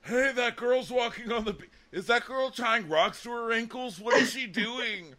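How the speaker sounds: high, goofy mock alarm; Kevin. Kevin spots crying girl on beach, mock alarmed. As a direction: call out in a high put-on voice. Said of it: "And Kevin's like".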